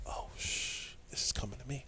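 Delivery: breathless whisper of dread; whispering under his breath, realizing the ball is coming to him; whispered panic. Retold or imitated. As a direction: hiss it to yourself as the play comes your way.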